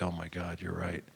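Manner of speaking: soft, awed aside; a hint of hushed wonder; hushed realization. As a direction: say it like a sudden quiet lightbulb. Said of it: "I was like".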